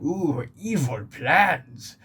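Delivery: slow hammy villain voice; mustache-twirling villain. Retold or imitated. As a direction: ham up a cartoon villain, slowly. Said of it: "the villain is this mustache twirling".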